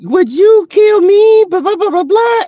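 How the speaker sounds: high, slow mock dramatic man's plea; movie character quote. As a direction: ham up the love line, high and slow. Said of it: "he was like".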